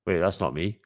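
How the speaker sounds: ordinary talk, nothing marked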